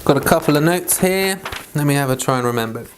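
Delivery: normal talking voice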